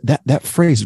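ordinary talk, nothing marked

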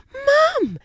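very high, loud cry for mum; high-pitched youngster's exclamation; exclaiming baby wallaby. A narrator's reading. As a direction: squeal it out as a little wallaby. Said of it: "exclaimed the wallaby".

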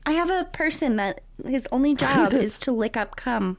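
high, laughing through the words. Real, not acted. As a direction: giggle while explaining. Laughing paraphrase.

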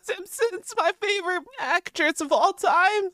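high-pitched cartoon kid voice; Morty. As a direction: play a squeaky young sidekick. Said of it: "could you do a Morty?".

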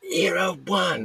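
very high strained yell, volume held back; high, rough screamed score call at contained volume; mock scream. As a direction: imitate screaming out a tennis score. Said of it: "yelling it".

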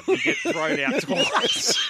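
high, loud, laughing through the words; helpless giggles mid-sentence; laughing about getting thrown out. Real, not acted. Both laughing at rule.

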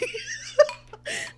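high burst of real laughter. Real, not acted. Telling friends the story, high real laugh. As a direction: break into laughter.

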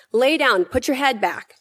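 normal talking voice

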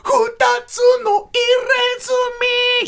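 very high, slow, stretched rock-star voice. Retold or imitated. Rock star brushing off the narrator, in a hammed-up voice. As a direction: drag every word out like a stage singer. Rock singer's menacing line.

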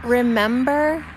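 imitating the skit, "remember" sagging like a flat tire. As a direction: stretch "remember" into a lopsided, deflating skit voice. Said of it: "they almost sound like they have a flat tire".

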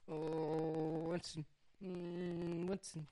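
high, sing-song pet talk; cooing to the dog. Real, not acted. Owner fondly coos at her dog Winston, high and sing-song.

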